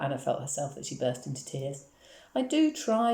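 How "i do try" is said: put-on high, pleading child's voice; mildly tearful protest, pitched up; sobbed. A narrator's reading. Upset girl protesting to her mother, sobbed. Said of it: "she sobbed".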